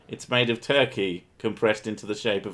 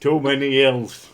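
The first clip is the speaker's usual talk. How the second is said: put-on Northern England accent; 3 semitones higher, 5 dB louder than usual. Playing a Northern flat earther, broad Northern England accent. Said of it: "accent that I think of".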